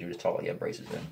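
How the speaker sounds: quick breathy whispered tease; girlfriend's teasing. Retold or imitated. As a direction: rush it out in a hushed tease. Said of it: "That's what my girlfriend does".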